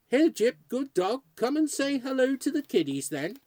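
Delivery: high, loud doggy coaxing voice; calling a dog. Acted. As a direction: coax the ghost dog over. Ghost calling his dog.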